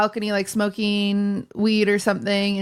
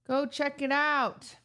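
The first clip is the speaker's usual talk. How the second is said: slow, drawn-out go check it out; slow repeat; 4 semitones higher, 9 dB quieter, slower than usual. Real, not acted. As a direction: spell it out slowly to them. Repeating the plug slower.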